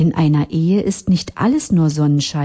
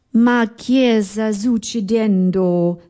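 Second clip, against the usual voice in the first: higher, slow Italian exclamation; calling out Gusto; 4 semitones higher, slower than usual. Acted. Gusto calling out across the card game, slow and higher. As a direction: call out in playful confusion. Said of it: "rief Gusto".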